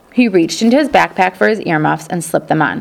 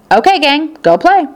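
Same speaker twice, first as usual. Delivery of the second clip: high, louder call to the class; teacher sending the class to recess, called out high and loud; 9 semitones higher, 4 dB louder than usual. Acted. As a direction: call the kids out to play.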